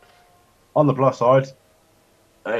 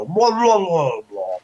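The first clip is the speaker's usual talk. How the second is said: high, swooping garbled "wah, wah"; Murloc noise imitation; 8 semitones higher, slower than usual. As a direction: gargle out a high Murloc call. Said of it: "they go".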